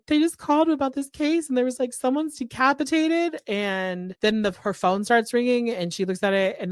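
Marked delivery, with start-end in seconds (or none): 0.1-3.4 high-pitched imitation of someone bawling; mock bawling. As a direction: retell it in a high, half-hearted wail. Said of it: "she's, like, bawling".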